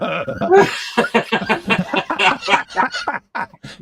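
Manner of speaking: high, loud cackle of laughter. Real, not acted. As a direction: crack up laughing. Laughing at own teasing.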